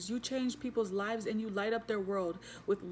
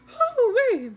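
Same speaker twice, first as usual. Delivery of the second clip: a real, much higher and louder whoop; high excited whoop; 13 semitones higher, 13 dB louder than usual. Real, not acted. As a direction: let the feeling burst out high. Overwhelmed by the reading.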